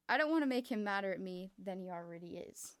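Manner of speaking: ordinary delivery, nothing marked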